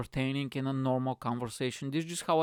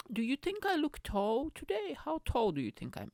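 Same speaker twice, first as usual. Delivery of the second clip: high coy voice; fishing for compliments; 12 semitones higher, 3 dB quieter than usual. Retold or imitated. Comedian asking women if he looks tall, high coy voice. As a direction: ask it coyly, fishing for praise.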